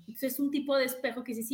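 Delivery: normal talking voice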